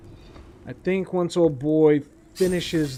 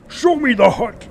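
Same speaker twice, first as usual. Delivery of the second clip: loud, high mock-dramatic demand; playful raised-voice command; demanding shout; 8 semitones higher, 8 dB louder than usual. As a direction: holler it like an impatient demand. Playfully demands to see finished hut.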